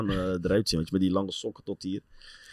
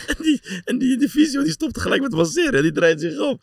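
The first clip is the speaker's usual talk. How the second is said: laughing while telling; 4 dB louder than usual. Real, not acted. Laughing while retelling prank.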